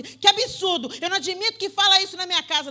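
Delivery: mock the bigmouthed scolding mother; outraged parent. Preacher mocks a mother scolding her son, indignant. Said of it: "abrir a sua bocona e falar assim".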